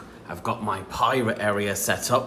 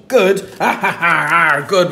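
high loud happy "Good"; excited cheer; 6 semitones higher, 8 dB louder than usual. Shown as a demonstration. Cheer for real word.

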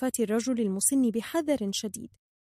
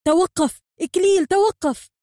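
loud, high, urgent yell; protective, commanding shout; full-voiced shout; 9 semitones higher, 10 dB louder than usual. A narrator's reading. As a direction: shout at the top of your voice to stop an attack. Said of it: "ليصرخ بكل صوته مدافعًا".

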